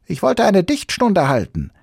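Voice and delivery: Sams explaining. Acted. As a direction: explain eagerly in a squeaky voice. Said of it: "sagte das Sams kleinlaut".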